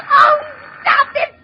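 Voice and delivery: very high, shrieking; terrified invalid screaming; hysterical scream. Acted. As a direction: scream at the phone to stop. Screaming at ringing phone.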